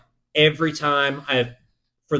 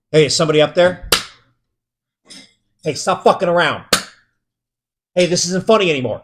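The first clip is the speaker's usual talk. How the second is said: raised, nervous call up into the ceiling; louder, to someone who might be hiding; 3 semitones higher, 5 dB louder, faster than usual. Retold or imitated. Alone in the building, calling to whoever is in the ducts, loud and uneasy.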